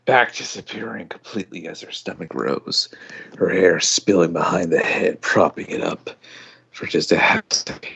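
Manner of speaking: hushed, unvoiced storytelling whisper; whispered narration. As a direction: breathe the words out in a whisper.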